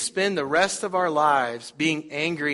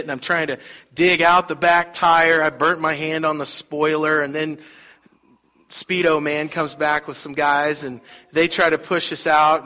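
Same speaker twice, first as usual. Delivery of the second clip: normal talking voice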